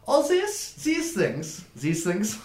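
high-pitched, put-on innocent voice; evasive dwarf merchant. Dwarf asked where he got the merchandise, high evasive innocence. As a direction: play innocent, repeating yourself in a high voice.

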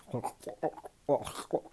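wordless throaty choking sounds; imitated gagging noise. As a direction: mimic muffled gulping noises. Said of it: "I was sucking his dick, like".

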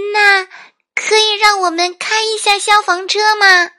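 put-on very high, loud little girl voice; pleading child. A narrator's reading. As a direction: ask big favour in a piping kid voice. Girl asks to drive truck. (又高又响的小女孩恳求声)

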